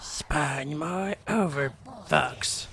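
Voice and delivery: slow hushed whisper; advisor whisper. Retold or imitated. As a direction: hiss it slowly like the game advisor. Mimics the game advisor's whisper.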